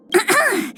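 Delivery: short, deliberate throat clear. Acted. Getting ready to address the Imperator, clearing the throat. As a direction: clear your throat before an announcement.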